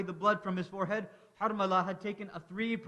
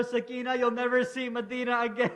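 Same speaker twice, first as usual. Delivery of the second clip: higher and louder, breaking into sobs; choked grief; 4 semitones higher, 4 dB louder than usual. Acted. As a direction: say it through tears. Father answering weeping daughter.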